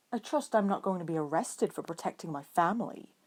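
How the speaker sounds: ordinary delivery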